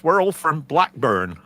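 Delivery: loud Lancashire Blackburn accent; northern English accent. Shown as a demonstration. Musician obliging a listener's plea, northern English accent. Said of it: "please do a northern accent".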